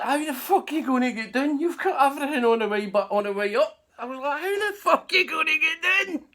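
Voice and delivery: loud, high, laughing disbelief; calling up the tree. Retold or imitated. Teller shouts up to Bones stuck in the tree, loud and high. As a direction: shout up at him, baffled.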